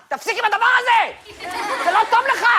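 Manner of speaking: high, hammed-up scolding; scolding pain. Brain-freeze pain warning the slush drinker, scolding. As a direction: cry out in high-pitched alarm. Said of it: "מין כאב שאומר לך".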